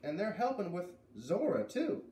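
put-on high, bright girl calling out; high-pitched girl calling across the yard; voiced farm girl calling. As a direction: call out as a friendly farm girl. Beat tells Zep.

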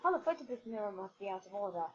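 ordinary talk

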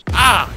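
short loud startled cry; sudden loud yelp of alarm. Real, not acted. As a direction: yelp as the car hits someone. Hits a pedestrian by accident.

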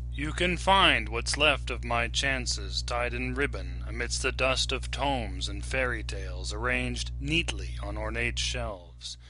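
usual speaking voice

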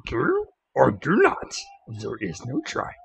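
slow, quiet little-sage voice; Yoda. Acted. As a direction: impersonate the old alien master, measured and soft. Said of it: "Where's Yoda?".

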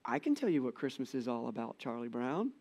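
put-on gently and simply, like a small wise kid; Linus voice. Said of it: "I always hear it in the voice of Linus".

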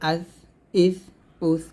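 আস, ইস, উস closed with a thin th; tongue tip on upper teeth for the ending of আস, ইস, উস; thin Tha. Shown as a demonstration. Teacher repeats the important th of আস, ইস, উস, thin Tha. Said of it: "উপরে দাঁতের আগায় লাগবে".

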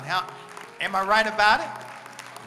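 loud rising preacher call; preaching shout. Real, not acted. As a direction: call out to the church.

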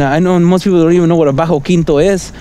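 ordinary delivery

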